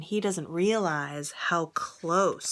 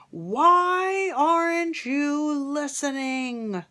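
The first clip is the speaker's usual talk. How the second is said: higher, louder and slow, exasperated; king shouting; 8 semitones higher, 5 dB louder, much slower than usual. Acted. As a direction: shout at the boy who won't listen. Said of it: "shouted the king".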